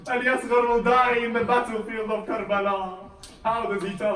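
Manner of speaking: loud, high, anguished sermon delivery; impassioned lament preaching. A narrator's reading. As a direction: preach the tragedy at full pitch.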